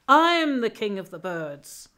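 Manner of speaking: loud, slow proclamation; triumphant eagle. Acted. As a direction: announce it like a proud ruler of the sky. Eagle proudly claims the crown.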